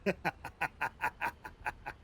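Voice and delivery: steady rolling chuckle. Real, not acted. Reading chat about the odd creation, rolling chuckle. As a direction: chuckle in short even bursts.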